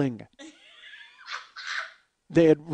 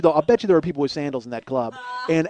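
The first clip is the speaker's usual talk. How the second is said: normal talking voice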